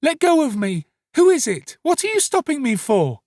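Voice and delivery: put-on very high, loud boy's protest; struggling cry. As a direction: shout to be let loose, in a small boy's high voice. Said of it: "cried Oliver, struggling".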